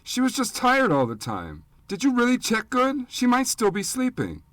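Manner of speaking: put-on high, loud childish denial; furious boy refusing the news of his mother's death; angry child. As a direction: snap back in a boy's defiant cry. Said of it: "Pete said angrily".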